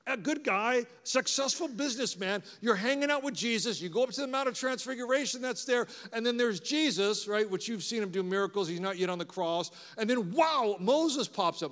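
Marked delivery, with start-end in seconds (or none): none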